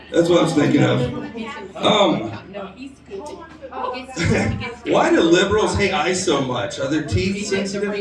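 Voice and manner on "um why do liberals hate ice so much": ordinary talk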